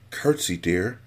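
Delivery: mother's hushed aside to Buttercup; whispered. Acted. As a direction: whisper a nudge to her daughter. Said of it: "Buttercup's mother whispered".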